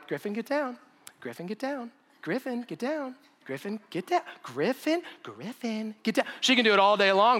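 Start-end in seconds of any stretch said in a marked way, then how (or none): 0.1-6.3 higher, softer, repeated calls to get down; a mother nagging her child over and over without effect; mommy's repeated warning. Retold or imitated. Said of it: "mommy can go".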